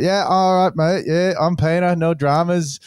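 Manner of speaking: lightly hammed English accent, eager to get the job; English accent impression. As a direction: send up an English backpacker's accent, high and keen. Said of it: "Pommy bastard, he's like".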